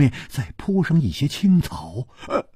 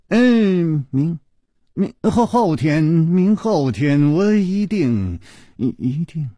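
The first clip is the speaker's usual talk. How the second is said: stammering promise; 2 semitones higher, slower than usual. Acted. Chu stammers a reluctant promise.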